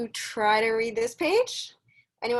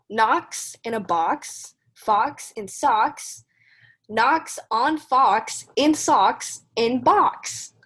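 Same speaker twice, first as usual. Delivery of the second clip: louder, slow, bouncy read-aloud rhythm; reading to children; 4 dB louder, much slower than usual. Acted. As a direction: read it out like a storybook for kids. Teacher reads Dr. Seuss aloud.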